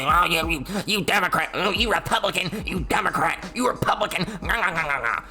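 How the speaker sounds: sing-song squabble between two clones; mock partisan bickering. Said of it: "and they argue".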